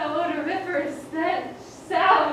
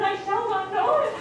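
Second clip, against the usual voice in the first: normal talking voice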